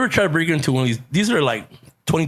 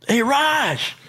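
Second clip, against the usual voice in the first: high call across the crowd; high hey across a parking lot; yelled out; 12 semitones higher than usual. Retold or imitated. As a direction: shout to grab his attention. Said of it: "so I yelled".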